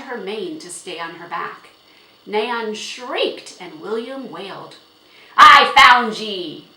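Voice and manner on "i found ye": very loud, drawn-out angry yell. A narrator's reading. Horse owner finding his horse with the girl, yelling. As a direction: bellow it, stretching every word. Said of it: "yelled Jiggy".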